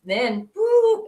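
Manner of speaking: usual speaking voice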